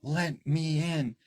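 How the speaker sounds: put-on high, drawn-out child's plea; eerie young boy. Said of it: "And he's like".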